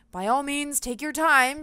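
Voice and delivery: lightly voiced captain, dry strained remark; a hint of effort under the sarcasm; a touch of grunting. A narrator's reading. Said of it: "she grunted".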